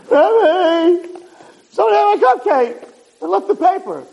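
put-on high squeaky kid voice; complaining schoolboy. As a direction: imitate a little boy whining to his rabbi. Acting a boy complaining to the rabbi.

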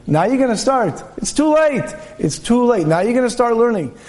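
high, scornful too-late taunt; taunting tempter. Acted. Evil inclination mocking an older man, high scornful taunt. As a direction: rub in that the chance is gone. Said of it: "the H R comes over you and says".

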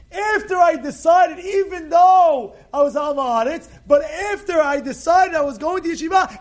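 high, loud, driving argument; emphatic arguing. Acted. Rabbi Akiva arguing about the promise, loud and emphatic. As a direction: press the point insistently.

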